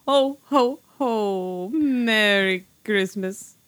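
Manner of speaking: booming jolly Santa laugh; belly-laugh it like Santa. Acted. Santa greeting at episode start.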